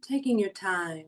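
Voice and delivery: hushed guiding voice for a body-awareness meditation; guided meditation. Acted. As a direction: ease them down into calm, slowly.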